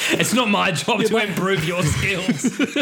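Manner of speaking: high, slow haughty teacher; mock teacher voice. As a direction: sneer it down at the pupils. Mocking a teacher kicking kids out.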